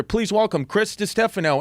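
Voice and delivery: a light nod to a TV host calling his name; host announcing. Retold or imitated. Host welcoming the comic onstage, light announcer voice. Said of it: "I just hear".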